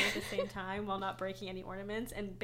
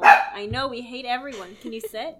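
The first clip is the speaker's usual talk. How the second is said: loud to the dog; 2 semitones higher, 12 dB louder than usual. Real, not acted. Talking to dog.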